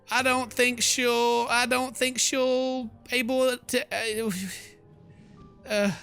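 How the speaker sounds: high, slow, drawn-out worried stammer; nervous stalling. Acted. Player backed against the wall by a ghost, nervous high drawl. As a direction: stretch each word out high, losing confidence.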